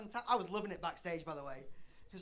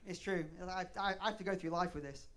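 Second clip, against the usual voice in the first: ordinary delivery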